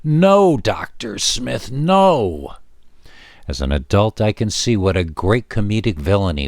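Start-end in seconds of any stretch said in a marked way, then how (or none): 0.0-2.5 high, drawn-out cry of protest; screaming at TV. A narrator's reading. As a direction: wail it at the screen like an exasperated kid. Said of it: "you were screaming at the television".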